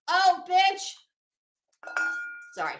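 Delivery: ordinary talk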